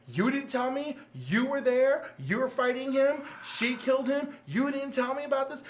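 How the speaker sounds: high, hurt, incredulous questions, imitating Wally; stunned disbelief. Wally confronting Barry, stunned.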